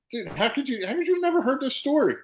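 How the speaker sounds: can't believe he never heard this; incredulous disbelief. Real, not acted. Asking his cohost how he missed the story, incredulous. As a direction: pitch jumps way up in real amazement.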